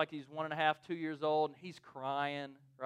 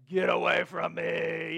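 drawn-out, louder mock shout; mock hostile yell; 5 dB louder, slower than usual. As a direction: act out a startled stranger warding someone off. Imagined hostile reaction from stranger.

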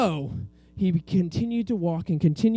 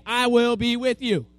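loud, raised, lead the group in repeating; louder, higher, deliberate prompt; call for repetition; 5 semitones higher, 5 dB louder than usual. Shown as a demonstration. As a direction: model it for the children to echo.

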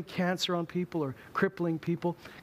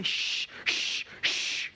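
disciples shushing; much slower than usual. Retold or imitated. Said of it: "the disciples say".

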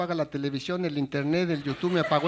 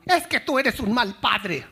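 slow, louder mock accusation; 5 semitones higher, 5 dB louder, slower than usual. Imagined spouse scolding a father, slow louder mock accusation. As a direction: fling the blame at him, slowly.

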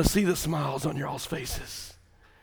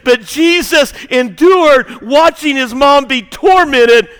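louder and higher, building preacher's fervor; preaching at full force; 11 semitones higher, 13 dB louder, slower than usual. Acted. Preacher to congregation about Jesus seeing his mother, loud preaching. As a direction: climb back up to a passionate pitch.

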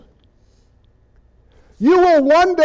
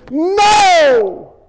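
sudden high loud No; real outburst of preaching fervor; worked-up shout; 7 semitones higher, 4 dB louder than usual. Real, not acted. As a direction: cut it off hard, preacher worked up. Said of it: "I'm getting all worked up".

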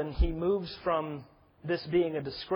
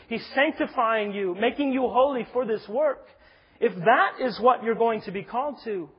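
higher, louder, insistent preacher's delivery; impassioned preaching; 7 semitones higher, 6 dB louder than usual. Real, not acted. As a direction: press each point home to the congregation with rising force. Preaches with rising force.

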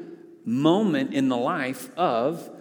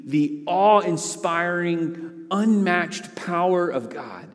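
slow, building, higher and louder; rising preaching; 4 semitones higher, 4 dB louder than usual. Acted. Preacher urging the congregation on God's power, building. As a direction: swell toward the climax.

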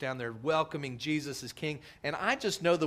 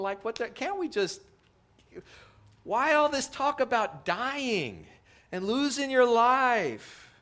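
high, pleading disbelief, slowing down at the end; like a friend who can't believe what he's hearing; incredulous protest; 9 semitones higher, 3 dB louder than usual. Retold or imitated. Disciple objecting to Jesus about dying, high incredulous protest.

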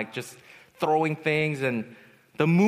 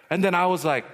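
ordinary delivery

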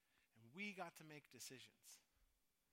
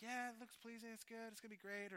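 mock persuaded voice; 9 semitones higher, 4 dB louder than usual. Voicing people talking themselves into it.

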